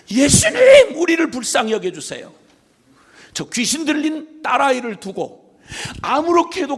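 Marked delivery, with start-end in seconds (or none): 0.1-2.3 name cried out high and loud; a loud, high cry for mercy. Retold or imitated. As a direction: call out desperately to Jesus. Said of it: "열 문둥병자가 외쳤던 말처럼".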